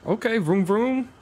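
vroom noise. Real, not acted. Imitates engine noise.

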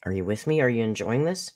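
normal talking voice

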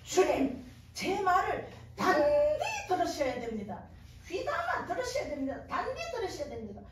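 loud, emphatic Gyeongsang dialect plea. Retold or imitated. Woman bargaining with the Lord in her dream, Gyeongsang dialect. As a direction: press the demand in Gyeongsang dialect. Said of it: "막 경상도 말로 막 말했습니다".

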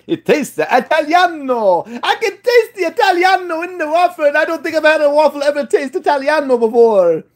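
high, sing-song mock Italian; hammed-up Italian exclamation; mock Italian accent. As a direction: gush in a put-on Italian accent. Playful gush over tasting Italian flavour.

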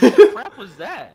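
loud, slow, laughing shout; laughing outburst. Real, not acted. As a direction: yell it while cracking up. Disbelief at an unexpected kill.

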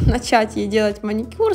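normal talking voice

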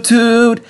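a far-off honk; distant toot. A narrator's reading. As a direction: bus tooting as it approaches. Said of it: "a distant toot".

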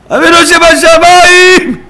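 very high, drawn-out scream of prayer; crying out to God. Shown as a demonstration. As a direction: cry it from the depths of the heart. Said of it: "אתה כל כך צועק להשם". (זעקה מעומק הלב)

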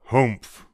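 loud gruff humph; a big bear's grunt; papa bear huffing loudly. Acted. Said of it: "said the papa bear in his great big voice".

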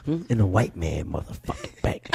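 Dick Gregory impression. Comedian retelling his elder grilling him about money, low and gruff. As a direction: drop way down and lean on him like a stern elder. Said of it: "He's like".

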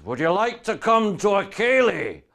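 loud, slow, high Scottish bellow; huge Scotsman inviting him to a ceilidh, Scottish boom; big Scotsman. Retold or imitated. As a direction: boom it out like a giant in a kilt. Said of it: "And he says".

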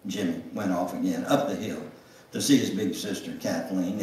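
ordinary talk, nothing marked

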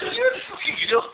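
high squealing laugh through the words; helpless laughter. Real, not acted. Cracking up at his friend's joke, high squealing laugh.

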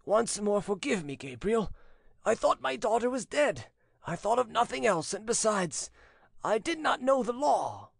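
put-on high-pitched, anguished old father; tearful voice. A narrator's reading. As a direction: beg forgiveness on the edge of tears. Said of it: "he said in a tearful voice".

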